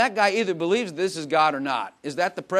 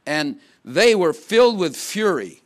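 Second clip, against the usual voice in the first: louder and slower, leaning on "filled"; dramatic fury; 8 dB louder, slower than usual. Acted. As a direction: boom it out like a storm rising. Preacher dramatizes angry crowd.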